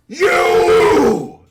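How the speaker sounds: long, high, very loud shout; drawn-out commanding roar; guard's yell. Acted. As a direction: bellow it across to the enemies. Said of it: "he lets out, like, a".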